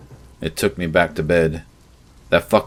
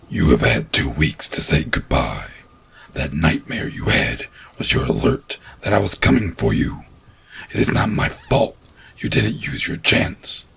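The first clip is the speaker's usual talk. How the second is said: thundering; 2 semitones lower than usual. A narrator's reading. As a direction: thunder the verdict. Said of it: "the monster thundered".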